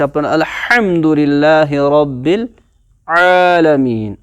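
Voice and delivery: slow Quranic recitation, throat letters stressed; teacher recites الحمدللہ to show the throat letters; recitation demo. Shown as a demonstration. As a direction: recite slowly, leaning on the throat sounds. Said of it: "نکلتے ایک ہی جگہ سے".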